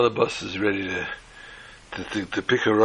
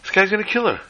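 alarmed bus riders; 6 semitones higher than usual. Acted. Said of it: "they're saying".

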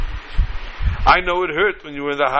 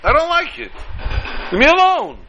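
yelled out; 9 semitones higher than usual. A narrator's reading. Teacup yelling at its master to stop. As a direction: cry out high to make him stop. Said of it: "I yelled out".

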